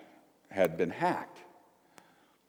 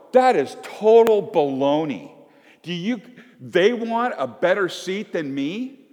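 indignant, raised arguing voice; arguing disciple; 8 semitones higher, 4 dB louder than usual. Acted. Disciple scoffing at the others, indignant.

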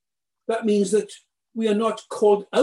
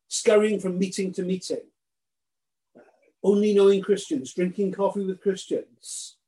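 usual speaking voice

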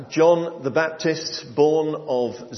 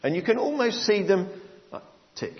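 ordinary delivery

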